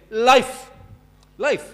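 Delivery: loud, high cry of "Life!"; shouting. Retold or imitated. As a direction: yell "Life!" as if running for it. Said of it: "he's shouting".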